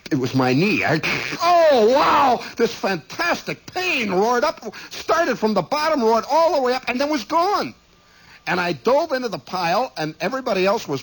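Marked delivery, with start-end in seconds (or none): 1.4-2.4 loud, high pained exclamation; acted cry of pain. Former player relives his knee cracking, crying out in pain. 2.6-7.7 high, heightened, pained retelling; dramatic retelling of pain. Retold or imitated. Player relives the pain roaring up, dramatically pained.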